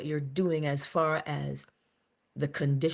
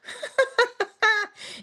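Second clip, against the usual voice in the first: a bright high laugh breaking out; high burst of laughter. Real, not acted. Laughing at her own Morpheus quote, high burst of laughter. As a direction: laugh high after quoting the movie.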